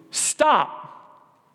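a sharp high cry of stop; cried out. A narrator's reading. Nancy stops her gloomy friend with a sharp cry. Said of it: "cried Nancy".

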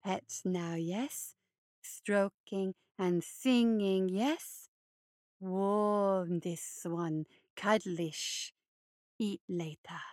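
low, slow, creepy hare voice; low creeping monster croon; hulking hare creature. Acted. Luther coaxes the child.

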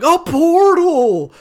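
high, slow gasp of wonder, hammed up; mock excited. Players spotting a portal, mock amazed and drawn out. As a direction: ham up the awe at a portal. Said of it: "They're like".

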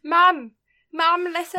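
loud playful call to mum. Pretend. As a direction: shout for mum across the house. Calling out to mum.

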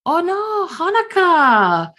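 put-on very high, drawn-out childlike cry of concern; high, slow, alarmed little-girl voice; worried gasp. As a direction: gasp her name, slowly, all worry. Gim sees Honoka crying.

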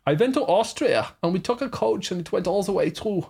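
put-on German accent. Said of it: "German, not German accent".

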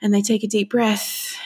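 ordinary delivery

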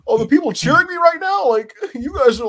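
high, loud hammed-up wrestler ranting at the crowd; mock Ethan Page promo. Podcaster mocks wrestler ranting at the crowd, high and loud. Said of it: "this man was talking about".

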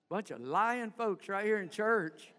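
ordinary talk

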